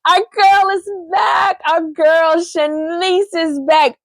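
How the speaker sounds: high, slow excited shout; excited announcement. Real, not acted. Host cheering an actress's return, excited. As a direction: announce her return with delight. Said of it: "And guess what?".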